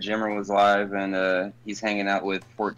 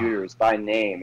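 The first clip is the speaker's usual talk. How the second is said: normal talking voice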